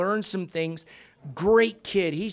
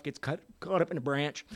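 ordinary talk

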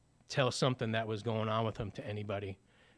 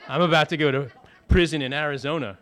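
laughing; 8 dB louder than usual. Real, not acted. Laughing at his own fate.

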